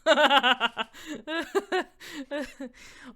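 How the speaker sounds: loud high-pitched burst of laughter; big laugh. Acted. Mania laughs at the question about shanties, big laugh. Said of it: "she just fucking laughs".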